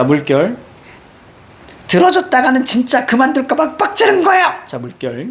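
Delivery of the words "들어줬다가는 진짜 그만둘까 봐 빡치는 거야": high, heated complaint; acted high-pitched rant; worked-up venting. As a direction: let the frustration spill out high. Voices angry mother venting.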